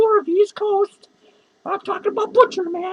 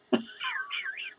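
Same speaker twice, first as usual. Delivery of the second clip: high giggle; 12 dB quieter than usual. Real, not acted. Drunk vlogger giggling at himself, high giggle. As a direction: giggle up high at your own joke.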